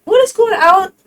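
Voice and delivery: high, loud disbelieving outburst; exasperated outcry. Real, not acted. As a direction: throw up your hands at grandmas.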